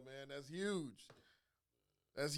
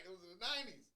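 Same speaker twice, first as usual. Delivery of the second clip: usual speaking voice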